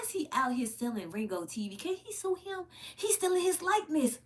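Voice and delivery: high, indignant disbelief; high outraged gasp of discovery; outraged realization. Real, not acted. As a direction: pitch way up, shocked and indignant.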